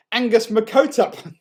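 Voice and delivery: high, loud, slow punchline, bursting with glee; boy delivering his own joke, high and loud with a snort. A narrator's reading. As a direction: deliver the pun like he can't contain himself. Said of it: "he snorted".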